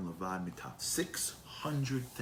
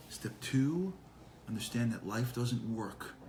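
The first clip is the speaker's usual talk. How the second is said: ordinary talk, nothing marked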